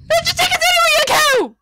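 high-pitched angry yelling; exasperated high shout; furious outburst. Acted. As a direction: lose your temper at the machine. Passenger loses temper at the lift.

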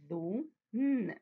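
sounding out each letter slowly; teacher spelling out a word for kids; slow syllable spelling. Shown as a demonstration. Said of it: "بالراحة عشان أعرف كل حرف".

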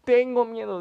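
high loud mock yell of fear; Auronplay. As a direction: yell it like the youtuber, hammed-up. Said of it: "diría Auronplay". (grito exagerado de miedo imitando)

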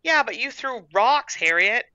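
put-on protesting child. A narrator's reading. Little sister reminds Harriet what she did, protesting. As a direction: object like a worried little girl.

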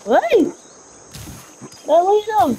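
high-pitched commanding call. Real, not acted. Commanding the game creature.

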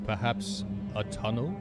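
usual speaking voice

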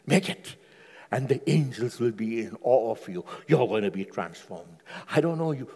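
ordinary talk, nothing marked

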